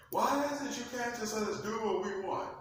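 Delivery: Lucifer whines up at his father; whiny rebellious Lucifer. Acted. Lucifer complaining to God, high whiny voice.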